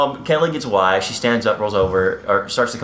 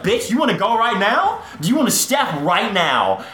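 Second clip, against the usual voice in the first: mock challenge; 7 semitones higher than usual. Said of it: "And Kelly's like".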